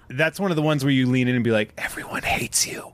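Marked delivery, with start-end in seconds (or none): 1.8-2.9 a mock whisper into his ear; whispered mock insult. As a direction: lean in and breathe it at him. Leaning in to a stranger.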